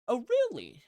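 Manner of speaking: high swooping mock surprise; mocking disbelief. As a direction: taunt with exaggerated disbelief. Villain mocks her threat.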